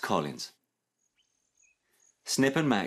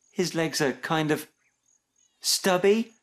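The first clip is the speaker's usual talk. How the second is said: higher, tentative offering; helpful suggestion; 8 semitones higher than usual. A narrator's reading. Max finishing a thought about dad's legs, helpful suggestion. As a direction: offer it helpfully, lifting the last word. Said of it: "suggested Max helpfully".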